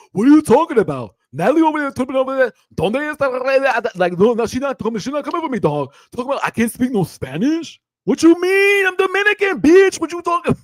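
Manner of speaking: high, loud mock tirade in a put-on accent; Biggie impression. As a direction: imitate an outraged reality-show star, building to a shout. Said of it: "Yeah, I'm doing Biggie".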